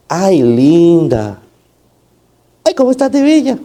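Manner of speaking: hammed-up doting compliment; mock sweet flattery. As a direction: gush over her with exaggerated sweetness. Mock gushing messenger, wrong tone.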